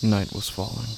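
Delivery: slow, hushed, half-whispered dread; eerie horror narration. A narrator's reading. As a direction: draw the listener into the dark pond, slowly. Eerie horror story narration opening.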